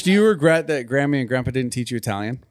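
normal talking voice

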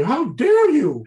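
high, loud, slow mock outrage. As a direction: play the scandalized curator, hammed up. Imagined indignant refusal by host.

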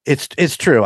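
ordinary delivery

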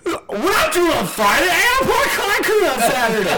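high, loud, laughing whine; mock whiny friend. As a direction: whine it like a spoiled buddy. Heated gym argument, friend voiced.

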